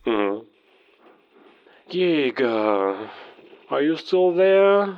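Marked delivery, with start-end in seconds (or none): 1.9-5.0 mocking taunt. Villain taunts over radio.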